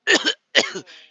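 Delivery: loud double hacking cough; imitated cough. Warning a truck driver about the produce, loud imitated coughing. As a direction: demonstrate his coughing, loud and sudden. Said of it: "That man over there coughing".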